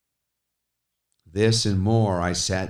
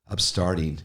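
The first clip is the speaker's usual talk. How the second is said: plain reading voice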